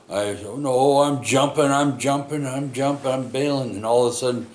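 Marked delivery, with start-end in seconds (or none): 0.5-3.7 put-on higher, louder panicky replay of a kid about to bail; panicked. Kid answering his yelling dad in a spinning truck, loud panicky cry. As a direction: blurt it high and loud as if about to jump out.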